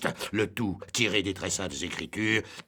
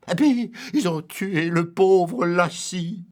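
plainte aiguë, lente et forte; lamentation outrée; 9 semitones higher, 5 dB louder, slower than usual. Acted. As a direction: pleurnicher avec emphase. Ubu se lamente, théâtral. (lamentation théâtrale, lente)

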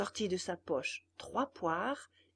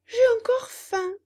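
much higher, louder hungry whine; whiny puppet; 14 semitones higher, 11 dB louder than usual. Acted. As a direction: beg for more food. Puppet whines after yawning.

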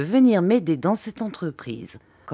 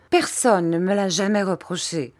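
plain reading voice

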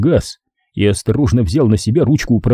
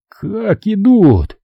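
high, slow astonished gasp; gasping in awe; 10 semitones higher, much slower than usual. A narrator's reading. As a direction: breathe it out in amazement. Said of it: "ахнул президент".

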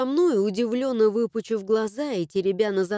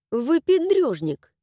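scoffing; 6 semitones higher than usual. A narrator's reading. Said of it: "успела хмыкнуть я".

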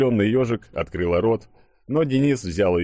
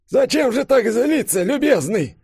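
put-on very high, louder elderly voice; puffing old man; 5 dB louder than usual. A narrator's reading. Old professor climbing out, chiding the angry boss, puffing. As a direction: puff it out in a high old man's pitch. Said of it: "пропыхтел Максим Эдуардович".

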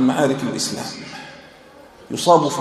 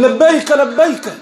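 eager answer; 11 semitones higher, 5 dB louder, slower than usual. Retold or imitated. Wounded man answers the battle call.

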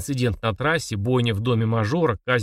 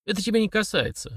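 higher, sharp cutting reply; sharp retort; 10 semitones higher than usual. A narrator's reading. Father cutting off his son, sharp. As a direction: snap it shut on him. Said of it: "резко произнёс Сергей".

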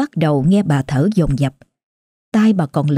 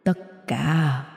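low, soft, slow voice from beyond; quiet, slow ghost of a grandfather; ghostly grandfather; 3 semitones lower, 8 dB quieter, slower than usual. A narrator's reading. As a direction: speak like a dead grandfather whispering behind her. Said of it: "Ông lại tiếp tục thở dài rồi lại nói".

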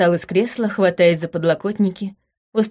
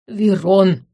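sharp, louder rebuke of a name; raised voice; 4 dB louder than usual. Acted. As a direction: call him to order firmly. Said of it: "возвысил голос Бесах".

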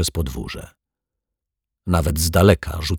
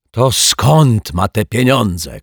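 slow, louder, higher outburst; exasperated shout; 4 semitones higher, 5 dB louder, slower than usual. Acted. Frustrated janitor demanding an answer, shouting. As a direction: burst out with it, drawn out. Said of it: "krzyknął pan Mietek".

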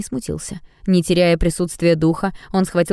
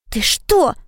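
startled exclaim; 10 semitones higher than usual. Acted. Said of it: "вскликнули Ода с Фредом".

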